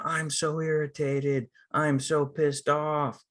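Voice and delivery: slow, high, hammed-up annoyance; mock indicated irritation. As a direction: overplay announcing your feelings, word by word. Mocks declaring feelings in the moment.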